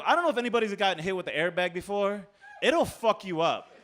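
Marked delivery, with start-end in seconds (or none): none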